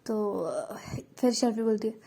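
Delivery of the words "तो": ordinary delivery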